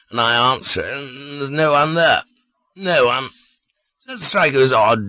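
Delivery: put-on lightly slurred, morose drunk; drunk man voice. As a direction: brood over it, a bit drunk. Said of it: "He was drunk, morose, and lonely".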